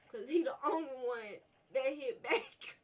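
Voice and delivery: loud, high complaint about the stag; indignant outburst. Real, not acted. Gamer complains loudly that the stag hit back. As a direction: protest it loudly, half laughing.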